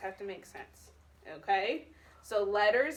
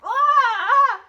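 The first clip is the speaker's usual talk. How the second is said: sudden high loud "Ah!"; shocked shriek; 12 semitones higher, 16 dB louder than usual. Shown as a demonstration. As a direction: yelp in shock. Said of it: "because she's saying it".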